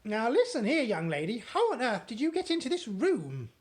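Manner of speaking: loud, high angry demand; furious shout. Acted. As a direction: bark at the girl. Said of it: "she shouted furiously".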